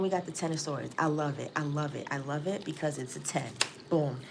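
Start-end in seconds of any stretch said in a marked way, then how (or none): none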